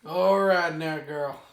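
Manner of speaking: gruff cowboy drawl; Arthur Morgan voice. Acted. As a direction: talk to the horse like an outlaw cowboy. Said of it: "starting to sound like Arthur Morgan".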